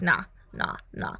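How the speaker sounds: low, soft, dismissive nah; lightly voiced father waving her off; dismissive dad. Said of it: "And the dad's like".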